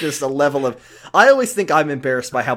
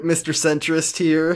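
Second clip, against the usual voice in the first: ordinary talk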